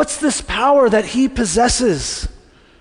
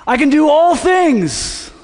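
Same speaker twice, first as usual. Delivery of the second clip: slow, higher, louder arena-preacher proclamation; grand proclamation; 6 semitones higher, 3 dB louder, slower than usual. Retold or imitated. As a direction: proclaim it to a packed stadium. Paul addresses huge arena conference.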